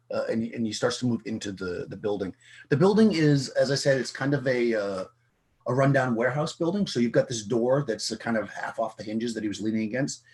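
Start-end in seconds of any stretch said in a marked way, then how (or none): none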